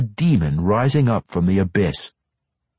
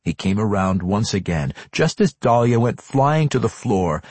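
usual speaking voice

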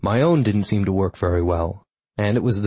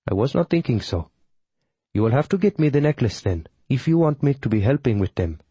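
put-on light Tamil-accented witch voice; calm, measured, mild Tamil accent; 4 semitones higher than usual. A narrator's reading.

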